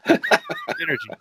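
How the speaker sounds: giggling through the word; laughing. Real, not acted. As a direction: laugh it out, slow.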